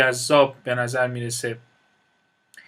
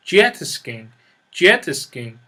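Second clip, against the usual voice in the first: jet skiing stressed on jet, louder; stress on first part; 2 semitones lower, 5 dB louder, slower than usual. Shown as a demonstration. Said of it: "the stress is on the first syllable".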